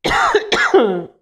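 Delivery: sudden choking cough; swallowed the wrong way. Real, not acted. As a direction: cough hard, as if something went down wrong. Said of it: "j'ai avalé de travers".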